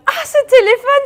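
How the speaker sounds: high, loud recognition cry; excited. Real, not acted. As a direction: exclaim as the penny drops. Reacting to partner's answer.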